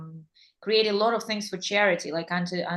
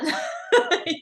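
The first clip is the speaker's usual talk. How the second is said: breathy laugh; 10 semitones higher, 3 dB louder than usual. Real, not acted. Laughing after the quote.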